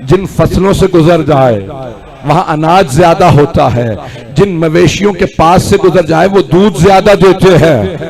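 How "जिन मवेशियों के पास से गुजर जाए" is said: usual speaking voice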